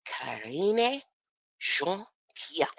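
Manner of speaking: saying "Jean-Pierre" with a French lilt, zhahn-pee-AIR; "Karine Jean-Pierre" in a half-hearted French accent; French-style name. Shown as a demonstration. Reading out the press secretary's name in a mild French accent. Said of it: "I don't wanna have that type of accent".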